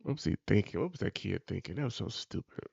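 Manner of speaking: usual speaking voice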